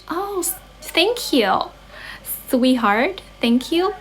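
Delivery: high, drawn-out sweet thank-you; gushing thanks. Pretend. Thanking a viewer for calling the speaker charming, playful gushing. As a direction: coo it in a high pitch, playfully flattered.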